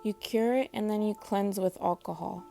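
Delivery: usual speaking voice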